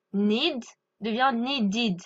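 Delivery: "needed" said slowly with a full id ending, NEE-did; clear ED ending. Shown as a demonstration. Teacher slowly spells out the sound of "needed" for students. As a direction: stretch out "Need devient needed" so the final -ed is heard as its own syllable. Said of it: "on prononce bien le E D".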